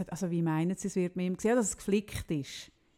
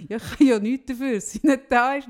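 husband defending himself; 3 semitones higher, 8 dB louder than usual. Retold or imitated. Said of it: "Und er so".

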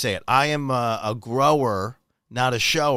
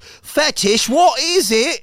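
mock British TV announcer, pitched high and louder; British accent; 10 semitones higher, 5 dB louder, much slower than usual. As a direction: put on a high British accent for a TV segment intro. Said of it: "I don't know why I'm British".

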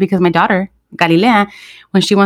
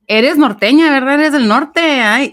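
high, singsong northern Mexican teasing; imitated northern Mexican lilt, pitched up; teasing norteño accent; 7 semitones higher than usual. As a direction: mimic people poking fun at a norteña accent. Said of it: "and they would do that".

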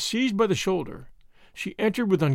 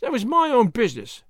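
higher, sullen servant retort; 5 semitones higher than usual. A narrator's reading. Maid caught talking over the hedge, sullen retort. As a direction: answer back with a touch of sulky defiance. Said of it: "said the woman sullenly".

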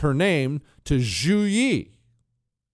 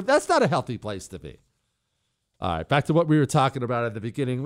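usual speaking voice

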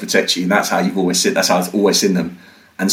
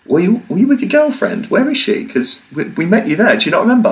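eager stranger; 11 semitones higher than usual. Retold or imitated. Stranger's voice at hostel.

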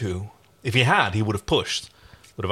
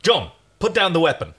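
louder, higher firm order; loud command; 7 semitones higher, 5 dB louder than usual. Acted. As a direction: call it out to stop him. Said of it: "said Aaron loudly".

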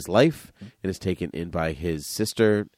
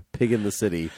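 normal talking voice

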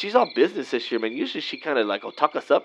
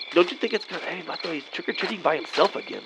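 ordinary delivery

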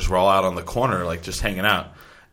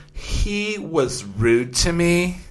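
put-on drunk girl voice; 7 semitones higher, much slower than usual. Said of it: "drunk girl quote".

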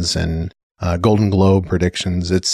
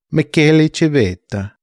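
put-on Italian accent; 9 semitones higher, 3 dB louder than usual. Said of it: "I cannot help but speak in an Italian accent".

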